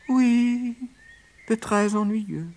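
very high put-on sad rabbit; rascal agreeing. A narrator's reading. Rascal rabbit agreeing with his accomplice, falsely sorry. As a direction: feign sympathy in a high little voice. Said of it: "approuva le coquin".

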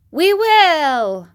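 high loud eager cry; shrill chorus. Acted. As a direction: shout it together eagerly. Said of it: "in a shrill chorus".

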